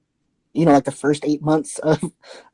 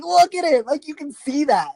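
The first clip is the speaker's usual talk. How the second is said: higher, thrilled showing-off; proud excitement; 12 semitones higher than usual. Retold or imitated. Showing off the new growth, proud and thrilled. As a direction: crow over it with delight. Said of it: "And I was so proud".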